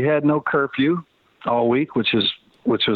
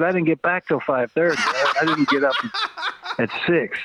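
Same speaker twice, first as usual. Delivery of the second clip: laughing disbelief; 4 semitones higher than usual. Retold or imitated. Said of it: "I said".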